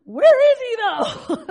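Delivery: high, loud call, then dropping back; loud anxious question. Retold or imitated. Mother searching the dark cliff for the dog, loud. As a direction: shout it into the dark, desperate.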